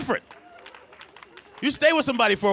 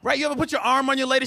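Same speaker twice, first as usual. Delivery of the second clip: normal talking voice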